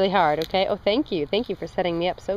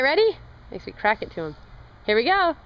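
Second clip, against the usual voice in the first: usual speaking voice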